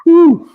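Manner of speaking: short, high, loud "woo"; playful whoop. Real, not acted. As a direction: give a quick high cheer. Cheering while joking with guest.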